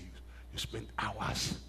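soft, slow, near whisper; tender hush. Retold or imitated. Husband thanking his wife, slow and hushed. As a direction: hush it out slowly, full of gratitude.

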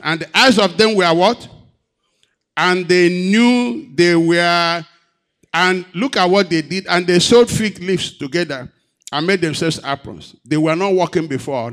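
loud, high preacher's cry; preaching loud. Acted. Preacher crying out to the church, loud and high. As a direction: drive the point home to the congregation.

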